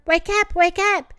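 put-on high, bouncy baby-bunny echo; little ones chiming in. Thumper's babies echo their father, high and bouncy. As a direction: chirp it like tiny bunnies. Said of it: "croused his new babies".

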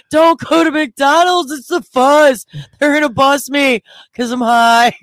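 high, loud, frantic pleading; hammed-up terrified whine; mock paranoid panic. Acting out her paranoid panic.

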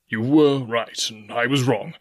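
a hint of a blustering squire; lightly voiced squire making amends; squire, owning up. Squire concedes to the captain.

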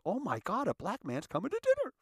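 high-pitched mock alarm; shocked parents. Mimicking shocked parents over their daughter's dinner guest, high mock alarm. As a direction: imitate scandalised parents in a high voice.

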